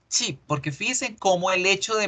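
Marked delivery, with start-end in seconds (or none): 0.1-0.4 "chip" with a smiled Spanish ee; "chip" as a Spaniard would read it; smiling Spanish i. Shown as a demonstration. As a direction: smile through the vowel of "chip". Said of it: "esa sonrisa".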